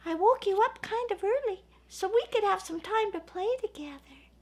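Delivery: high cheerful snowman voice; Sparkly, happy. Acted. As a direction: tell the bear you came early to play. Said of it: "And Sparkly said".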